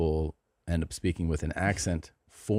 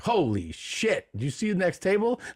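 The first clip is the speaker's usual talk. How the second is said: shocked; 11 semitones higher than usual. Retold or imitated. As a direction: gasp it out, stunned by what you see. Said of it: "you're like".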